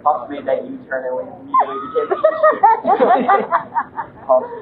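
bursting into laughter mid-sentence; laughing. Real, not acted. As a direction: laugh through the words. Laughing while telling story.

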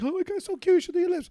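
very high cutesy mimicry of girlfriend; gushing girlfriend. Girlfriend begging him to do his lisp, high mock gushing. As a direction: put on a squeaky, gushing girlfriend voice. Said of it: "she's like".

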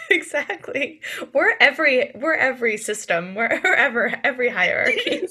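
loud, laughing through the words; laughing agreement. Real, not acted. Host laughs along with the guest's sibling story, laughing agreement. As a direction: agree while cracking up.

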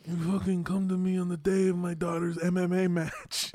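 a touch of a mob boss impression; Marlon Brando. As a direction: do a lightly voiced Godfather-style threat. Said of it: "It's basically Marlon Brando".

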